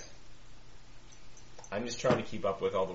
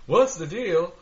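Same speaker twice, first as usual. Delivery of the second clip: stand-up comedian; 10 semitones higher, 4 dB louder, slower than usual. Pretend. Stand-up bit on "what's the deal.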